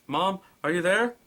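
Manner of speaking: anxious call into the phone. Acted. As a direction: reach her on a bad line. Calling mother on bad line.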